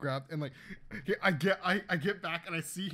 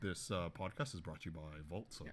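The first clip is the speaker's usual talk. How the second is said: low, soft deadpan ad voice; mock sponsor read; 11 semitones lower, 9 dB quieter than usual. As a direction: slip in a fake sponsor line, low and quiet.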